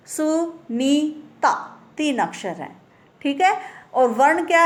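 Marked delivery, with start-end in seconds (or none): 0.1-1.8 सुनीता drawn out syllable by syllable, su-nee-ta; slow syllable demo. Shown as a demonstration. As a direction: sound out सुनीता slowly in three beats. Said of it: "सुनीता में भी तीन अक्षर हैं". (सुनीता को धीरे-धीरे अक्षर-अक्षर बोलना)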